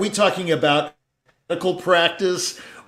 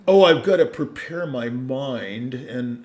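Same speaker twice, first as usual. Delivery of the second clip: mock solemn; 3 semitones lower than usual. Mocks a solemn pre-concert performer.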